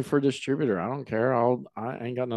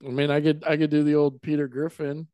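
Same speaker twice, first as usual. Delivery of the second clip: ordinary talk, nothing marked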